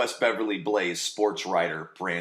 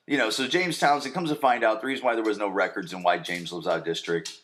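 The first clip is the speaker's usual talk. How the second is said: ordinary talk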